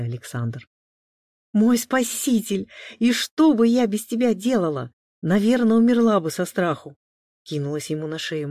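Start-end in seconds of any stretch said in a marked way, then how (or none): 1.5-7.0 higher, louder gushing relief; effusive gratitude. A narrator's reading. Maria throws herself on her rescuer's neck, effusive gratitude. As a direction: gush dramatically at your saviour.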